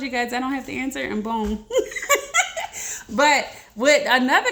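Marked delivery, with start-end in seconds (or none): 1.7-3.0 a squeal of laughter pitched way up; high delighted laugh. Real, not acted. Amazed at the timing with her followers, squealing laugh. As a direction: laugh at the happy coincidence.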